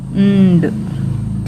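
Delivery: "ंड" as a drawn-out hummed n closing on a d; half n, full d. Shown as a demonstration. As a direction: hold the n in "ंड", then release the d. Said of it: "आधा न और ड". ("ंड" में आधा न खींचकर, फिर ड)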